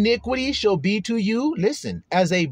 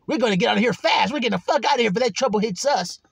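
hasty; 4 semitones higher, faster than usual. Retold or imitated. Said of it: "people fleeing in haste".

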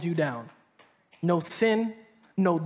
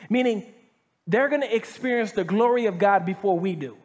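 ordinary talk

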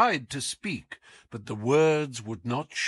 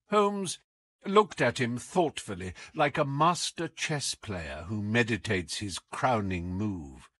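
usual speaking voice

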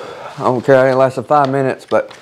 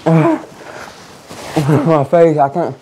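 mouth burning from spicy food; 4 semitones higher, much slower than usual. Real, not acted. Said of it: "You're wheezing".

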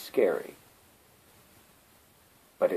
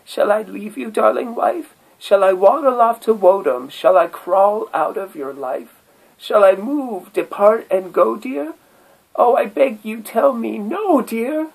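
very high, loud, desperate pleading turtle; begging turtle; 13 semitones higher, 6 dB louder than usual. Acted. Turtle begging his silent wife to say no, very high and desperate. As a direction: beg her not to let you go. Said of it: "Said the turtle to the bagpipe".

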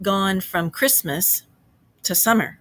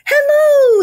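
very high, loud, drawn-out greeting; cheerful cry; 11 dB louder than usual. A narrator's reading. Toad greets his friends from the steps, cheerfully crying out. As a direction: sing out a jubilant hello. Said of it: "he cried cheerfully".

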